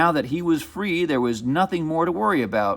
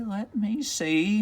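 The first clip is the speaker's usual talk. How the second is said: soft witch voice, slower, drawn out; slower musing witch; 6 semitones higher, 5 dB quieter, slower than usual. Acted. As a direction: stretch it as she keeps searching. Witch concentrates.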